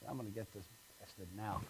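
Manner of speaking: very low, hushed joke voice; soft, low mock vow; plotting aside. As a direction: say it under your breath, scheming. Man plots revenge in joke.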